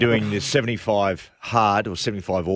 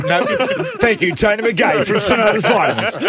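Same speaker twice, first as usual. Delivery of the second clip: much higher and louder, cracking up; laughing; 10 semitones higher, 9 dB louder than usual. Real, not acted. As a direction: laugh through the words. Laughing through a mock sign-off.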